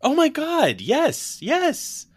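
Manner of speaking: very high, loud squealing yes; excited. Real, not acted. Realizing Ralph Macchio played Johnny, squealing excited yes. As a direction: burst of delighted recognition.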